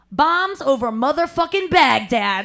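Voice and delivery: loud, slow, laughing blurt of the lyric; game-show buzzer answer, shouted; confident shout. Retold or imitated. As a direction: belt out the wrong answer with full confidence. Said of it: "he holds the mic and he goes".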